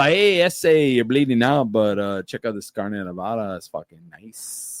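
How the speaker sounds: mock Norteño gangster voice, loud start, fading to a mumble. To a bleeding buddy, offering carne adovada in mock Norteño voice. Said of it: "I became Norteño real quick".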